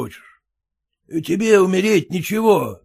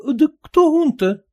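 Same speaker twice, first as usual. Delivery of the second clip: high, slow baffled wife; bewildered wife; 12 semitones higher, 4 dB louder than usual. Acted. Wife baffled by husband's talk, high slow voice. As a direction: drag it out in confusion. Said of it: "недоумевала жена".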